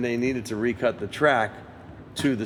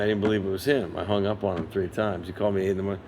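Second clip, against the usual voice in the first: normal talking voice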